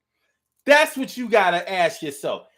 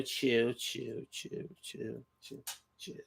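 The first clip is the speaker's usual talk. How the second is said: each "chill" softer until it's unvoiced; fading chill; 7 semitones lower, 14 dB quieter, slower than usual. Shown as a demonstration. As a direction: let "chill" fade out into a whisper. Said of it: "Emphasis on the chill".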